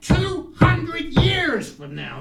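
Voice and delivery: "two hundred years" drawn out high and loud; "two hundred years" blown up big and slow; emphatic hyperbole. Acted. Hyperbole building to punchline.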